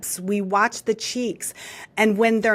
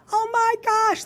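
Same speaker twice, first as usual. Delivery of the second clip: put-on high-pitched, animated teen gush; excited teenager. Showing how animated teens talk with friends, excited high gush. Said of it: "look at the sixteen and seventeen-year-old kids".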